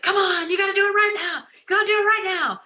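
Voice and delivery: hammed-up pushy closer, pressing hard; imitating a desperate recruiter begging; pushy salesperson. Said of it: "You're not saying that".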